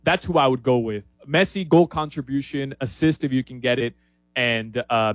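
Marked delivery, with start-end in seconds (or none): none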